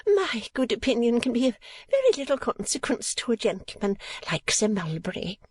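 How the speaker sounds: high-pitched coy modesty; would-be sly. A narrator's reading. Mother to the gentleman about Sir Mulberry, slyly self-effacing. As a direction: play coy in a high, fussy lady's voice. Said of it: "exulted in the idea that she was marvellously sly".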